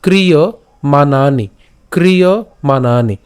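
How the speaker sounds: ক্রিয়মাণানি said slowly, kri-ya-ma-na-ni; slow syllabic ক্রিয়মাণানি; slow careful pronunciation. Shown as a demonstration. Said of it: "এরপর".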